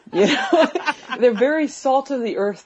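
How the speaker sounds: laughing while talking. Real, not acted. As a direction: laugh through the words. Laughs at own remark.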